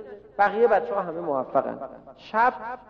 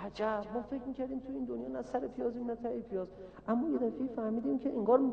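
quiet, higher-pitched voice of dawning amazement; hushed realization; 4 semitones higher, 11 dB quieter, faster than usual. Acted. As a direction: murmur it fast, as if suddenly realizing. (حیرت آهسته و زیرلب)

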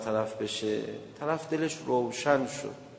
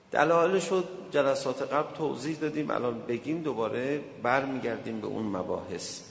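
ordinary talk, nothing marked

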